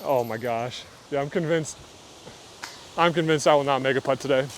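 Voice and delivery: normal talking voice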